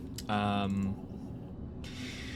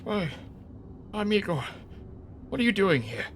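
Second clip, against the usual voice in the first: mouse voice; 9 semitones higher than usual. Acted.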